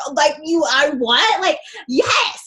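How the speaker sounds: high, loud, delighted gush; excited gush. Retold or imitated. Gushing about a stranger's soul, high and loud. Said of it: "And I'm like".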